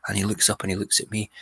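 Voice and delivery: ordinary delivery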